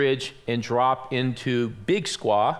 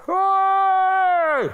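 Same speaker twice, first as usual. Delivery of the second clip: high, drawn-out loud call; imitated distant hail; 5 dB louder than usual. Man on the ridge hailing hikers below, calling out. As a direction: holler as if across a valley, imitating.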